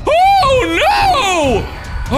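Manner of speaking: very high, loud "oh no"; startled shriek. Real, not acted. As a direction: yelp in real alarm. Game failure, shriek.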